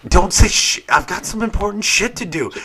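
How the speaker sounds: hammed-up loud dad brush-off; mock stern dad. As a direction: bark it like a busy dad.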